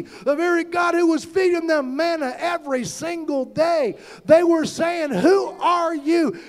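loud, rising sermon cadence; preaching at full force. Acted. Preacher rousing the congregation, full-force preaching. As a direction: preach it out, voicing the complainers. Preacher voicing complaining Israelites.

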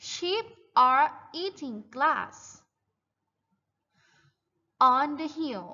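very slow, stretched-out sentence; model reading for repetition. Shown as a demonstration. Teacher reads the farm text for children to repeat, slow model reading. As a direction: read it out slowly, word by word. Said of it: "Repeat after me, guys".

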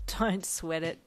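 usual speaking voice

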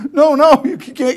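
high, loud panicked no; panicked refusal. Retold or imitated. Wife refusing the truck run, high loud panic. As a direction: cry out no in alarm.

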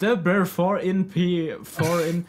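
mimicking a robotic English TTS, slow; TTS voice imitation. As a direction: mangle the name like a text-to-speech voice. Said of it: "wie sie meinen Namen vorliest". (TTS-Stimme nachmachen, langsam)

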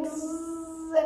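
soft imitated buzzing bee; quiet buzz of a trapped bee. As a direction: hum like a bee cupped in a hand.

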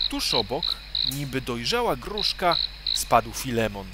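ordinary delivery

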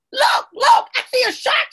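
high, loud urgent cry; mock alarm. A narrator's reading. Kojo tricking his friends about a shark, alarmed. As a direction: raise a sudden shark alarm. Boy pretends to spot a shark.

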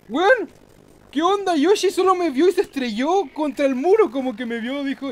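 loud, high startled outburst; real amazed shout; shocked yelling. Real, not acted. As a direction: shout in disbelief at the crash. Rival crashes, surprising win.

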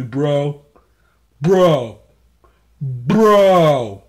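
loud, exasperated shouting; angry yelling. Pretend. Owner yelling at the dog that won't calm down. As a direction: blow up at the dog.

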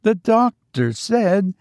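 high-pitched, anxious servant; high servant's voice, left hanging; old servant. A narrator's reading. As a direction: begin a timid objection up high. Servant protests, gets cut off.

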